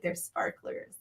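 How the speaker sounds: breathy whispered disbelief; exasperated whisper. Retold or imitated. Half-awake mother telling the neighbor they're only sparklers, whispered. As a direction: whisper it, too tired to argue. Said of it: "I'm like".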